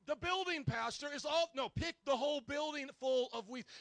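ordinary talk, nothing marked

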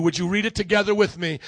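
normal talking voice

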